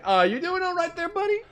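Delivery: very high put-on voice; concerned buddy impression. Character checking on crying cop, high mock-concerned voice.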